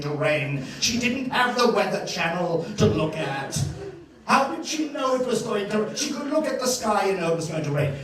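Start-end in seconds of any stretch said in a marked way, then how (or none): none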